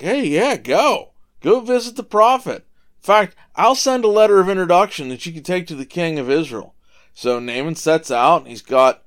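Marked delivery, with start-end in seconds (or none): 0.0-6.7 a king's hearty, raised go-ahead, higher and louder; eager king. Retold or imitated. King eagerly sends Naaman to the prophet, hearty and raised. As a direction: wave him off with brisk royal enthusiasm. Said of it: "the king's like".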